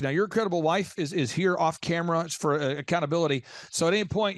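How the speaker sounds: ordinary talk, nothing marked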